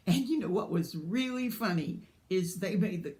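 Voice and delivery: usual speaking voice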